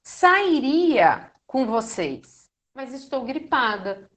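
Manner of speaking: slow, drawn-out example sentence; slow demo. Shown as a demonstration. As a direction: lay out each word slowly. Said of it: "Então, dá uma olhada". (frase de exemplo bem devagar)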